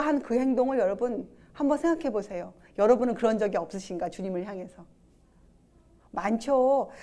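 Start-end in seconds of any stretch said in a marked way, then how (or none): none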